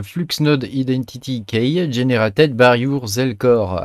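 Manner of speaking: slow English read in a heavy French accent; English words said the French way; bad French-accented English. Real, not acted. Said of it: "avec mon super accent bien pourri".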